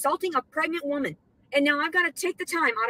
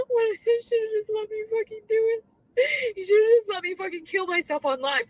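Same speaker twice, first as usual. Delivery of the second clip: high, louder, sobbing reproach; real tears, accusing; weeping complaint; crying; 6 semitones higher, 3 dB louder than usual. Real, not acted. Crying at God.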